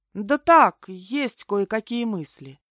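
offhand, slowing into vagueness; vague. A narrator's reading. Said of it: "туманно ответила я". (туманно, уклончиво)

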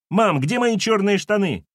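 put-on high-pitched child's yell; falsetto shout. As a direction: holler for mum in falsetto. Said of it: "фальцетом закричал Тим".

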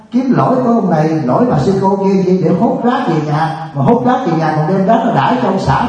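scolding monk laying into the crowd, preaching at full force; fiery preaching. Acted. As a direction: preach hard at the congregation, scolding. Monk scolding congregation in sermon.

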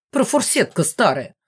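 outraged insult flung at a rival; indignant. A narrator's reading. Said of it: "возмутилась мама".

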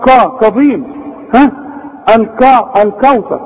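"الكوثر" with a thick, backed K; wrong heavy Kaf. Shown as a demonstration. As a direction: make "كظيم" start heavy and dark. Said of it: "غلط". ("الكوثر" بكاف مفخمة غلط)

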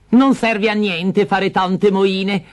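high, very slow contemptual rebuke; scornful stepmother. Acted. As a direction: drag out the scorn.

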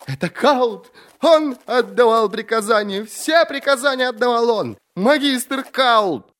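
very high, frantic shouting; hysterical accusation. A narrator's reading. Prisoner naming the magister for the court, hysterical. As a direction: cry it out in rising hysteria.

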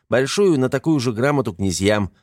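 ordinary delivery, nothing marked

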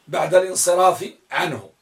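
ordinary talk

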